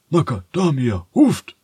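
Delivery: loud, high barking call of the farm dog; a yard dog barking out the alarm. Acted. Yard dog calling the others awake, loud bark. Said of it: "hörte sie den Hofhund bellen".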